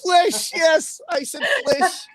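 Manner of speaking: high-pitched, tearful laugh-talk; laughing too hard to talk; crying with laughter. Real, not acted. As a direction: barely get the words out through laughter. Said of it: "I'm crying".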